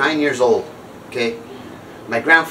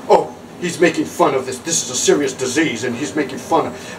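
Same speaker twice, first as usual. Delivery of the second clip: lightly voiced, prissy disapproving old-timer; mocking the diehards; 3 semitones lower than usual. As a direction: sniff at him like a self-righteous critic. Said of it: "Some people go".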